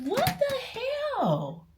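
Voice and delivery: very high, slowed disbelief; shocked squeak. Retold or imitated. Shocked reaction retold.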